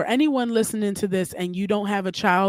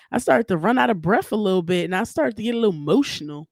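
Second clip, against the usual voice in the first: normal talking voice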